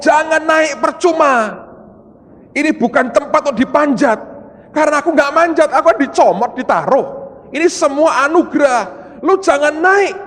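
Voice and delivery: loud, high call down from above; retold shouted warning. Preacher retelling his warning to climbing ministers, loud and high.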